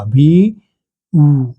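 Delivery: वी said as "bhee", then a separate ऊ; wrong pronunciation. Shown as a demonstration. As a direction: split into "bhee... oo", drawn out. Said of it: "ये गलत होगा".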